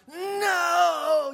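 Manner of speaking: grandma shouting to stop the throw; yelled no. Retold or imitated. Grandma yelling at a child about to hit the vase, loud high cry. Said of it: "the grandparent yells out".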